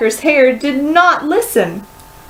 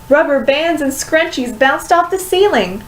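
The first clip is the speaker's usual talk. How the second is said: usual speaking voice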